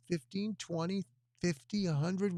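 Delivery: ordinary talk, nothing marked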